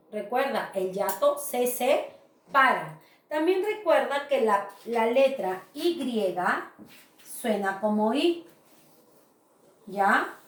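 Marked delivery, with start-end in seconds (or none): none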